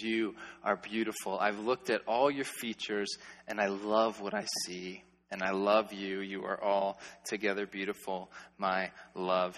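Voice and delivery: soft, low, tender words to a bride; tender groom. Acted. Preacher voices groom telling bride she is beautiful, soft and tender. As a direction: speak it softly as a loving groom.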